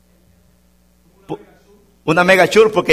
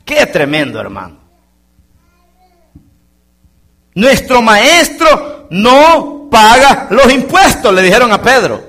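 slow, louder preaching cadence; fervent preaching; 4 semitones higher, 3 dB louder, slower than usual. Acted. Pastor reads the Bible story to the church in preaching cadence. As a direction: preach it from the pulpit.

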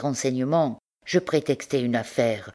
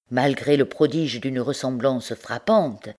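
usual speaking voice

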